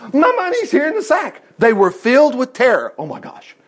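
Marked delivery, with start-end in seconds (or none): 0.1-1.4 high-pitched, acted panic; hammed-up shocked discovery; alarmed exclamation. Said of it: "he exclaimed to his brothers". 3.0-3.6 very soft, voiceless gasp of horror; hushed, horrified aside; whispered dread. Acted. Brothers filled with terror at discovery.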